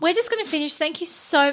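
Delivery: high, put-on phone sign-off; customer service voice. Shown as a demonstration. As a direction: attempt a chirpy high agent voice. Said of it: "this feels, uh, no, I can't do it".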